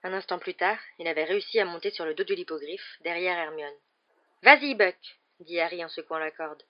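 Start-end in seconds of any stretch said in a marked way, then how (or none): 4.4-5.0 loud, higher call; urging command. Acted. Harry urging Buck upward, loud command. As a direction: spur the animal on.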